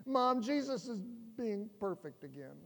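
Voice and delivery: hammed-up jealous little brother; whiny sibling. Jealous brother tattling to Mom, mock whine.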